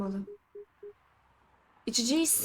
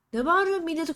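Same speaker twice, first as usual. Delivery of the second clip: scolding shout; 7 semitones higher, 8 dB louder, faster than usual. Acted. Scolding the roaring werewolf.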